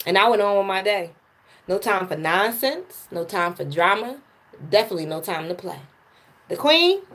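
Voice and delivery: spoken-word delivery, measured with pauses; poem recital. Acted. Reciting her poem to livestream viewers, spoken-word style. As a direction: let each line land like a performance poem.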